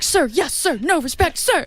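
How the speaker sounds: high, snappy mock salute; soldier answering general. Singer answering Neil like a general, mock soldier. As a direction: bark it like a recruit. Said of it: "like having orders from the general".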